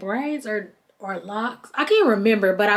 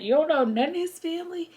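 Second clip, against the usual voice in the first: higher, quick, in mock disbelief; imitating stunned onlookers, higher pitched; shocked disbelief; 8 semitones higher, 4 dB quieter, faster than usual. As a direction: gasp it out as if the whole group is stunned. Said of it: "everybody looking like".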